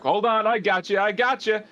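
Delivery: urgent reassurance. Acted. Game master voices someone catching falling friends, urgent reassurance. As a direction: call up to falling friends, ready to catch them.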